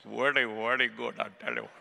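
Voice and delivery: low mock Indian accent. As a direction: imitate an Indian speaker, pitched low. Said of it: "from India".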